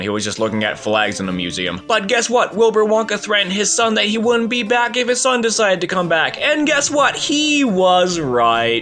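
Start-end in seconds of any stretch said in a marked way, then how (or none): 1.9-8.8 high-pitched, exasperated mock amazement; incredulous rant. Real, not acted. Reviewer mocking Wilbur Wonka's threat coming true, incredulous.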